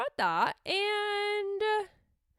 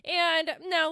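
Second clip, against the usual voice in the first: usual speaking voice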